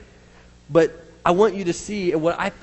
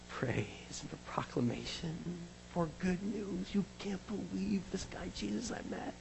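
very soft, near-whispered wonder; hushed awe; 15 dB quieter than usual. Acted. Preacher voices good news of Jesus, hushed and amazed. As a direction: share it like astonishing news, hushed.

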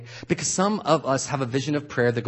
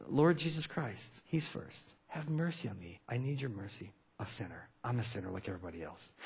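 near-whispered humble prayer; 3 semitones lower, 12 dB quieter than usual. Shown as a demonstration. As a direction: pray it low and humbly, almost unvoiced. Intimate prayer, heart humility.

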